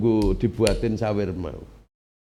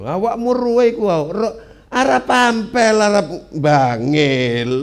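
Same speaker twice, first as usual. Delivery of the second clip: emphatic raised voice; 9 semitones higher, 6 dB louder than usual. Real, not acted. Arguing loudly about Arabs.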